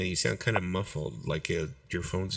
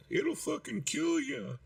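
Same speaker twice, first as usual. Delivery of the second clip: high, quiet, far-off mocking voice; mock doctor warning; 5 dB quieter than usual. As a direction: ham up a dire warning. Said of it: "they start telling you this shit like".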